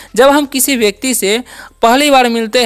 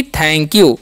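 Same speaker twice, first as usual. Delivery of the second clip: slowly joins thank and you; drawn-out thank you; slow pronunciation demo; 6 semitones lower than usual. Shown as a demonstration. Teacher joining thank and you for students, slowly. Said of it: "इसे हम लोग पढ़ते हैं यू".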